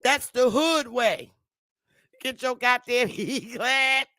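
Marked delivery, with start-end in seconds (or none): none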